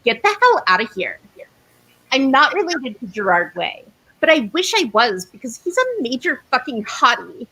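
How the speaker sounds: ordinary talk, nothing marked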